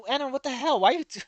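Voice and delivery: high, rising disbelief at the screen; Why are you, what?, baffled and climbing; exasperated outburst. Real, not acted. Player baffled by Anna's reveal, rising disbelief.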